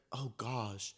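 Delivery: very soft aside to oneself; muted dismay. Retold or imitated. Seeing the whole friend group in the car, muted dismay. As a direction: let it slip out under the voice.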